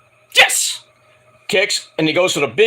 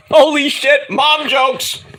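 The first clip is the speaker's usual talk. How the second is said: hyped; 8 semitones higher, slower than usual. Real, not acted. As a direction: cheer it like a delighted fan. Hyped by the insult.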